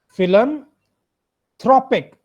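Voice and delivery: Philanthropic drawn out slowly, stress on throw. Shown as a demonstration. Teacher breaks up philanthropic for students, slow with stressed throw. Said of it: "थ के ऊपर stress है".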